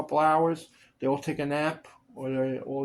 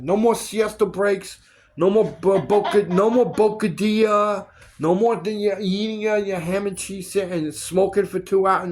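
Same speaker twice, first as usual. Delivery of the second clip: loud, higher-pitched retold tirade; crazy on-set rant; 4 semitones higher, 6 dB louder than usual. Fed-up actor scolding crew over siesta breaks, ranting. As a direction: lay down the law, re-enacting your blow-up. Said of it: "I went crazy one day on set".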